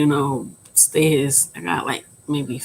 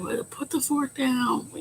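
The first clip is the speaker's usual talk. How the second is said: higher, softly voiced jeer from critics; mocking imitation; 9 semitones higher, 4 dB quieter than usual. People making fun of fat people, mock dismissive.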